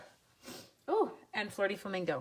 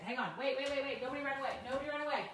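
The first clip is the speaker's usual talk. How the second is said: hurried pleas; 5 semitones higher, 4 dB quieter, faster than usual. Real, not acted. Rushing off for a towel.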